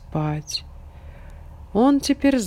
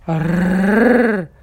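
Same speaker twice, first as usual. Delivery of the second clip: growling dog voice; 6 dB louder than usual. A narrator's reading.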